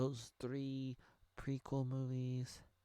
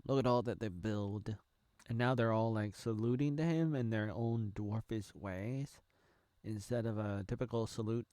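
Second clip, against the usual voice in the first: normal talking voice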